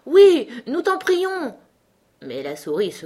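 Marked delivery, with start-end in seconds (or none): 0.0-1.5 loud, high, drawn-out plea; stretched, shouted entreaty; chorus exclamation. A narrator's reading. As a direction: call out slowly like a whole crowd begging. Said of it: "tous les autres s'exclamèrent en chœur".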